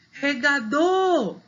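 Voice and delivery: REGADOR with DOR high and drawn out; calling the word. Shown as a demonstration. Teacher demonstrating final stress, calling regaDOOR. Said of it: "o dor é a sílaba mais forte".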